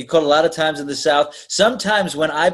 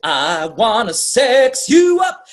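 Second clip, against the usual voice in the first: slow, louder crooning come-on; mock sexy man; 7 semitones higher, 5 dB louder, much slower than usual. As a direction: croon it slowly as a joke. Sexy message recorded.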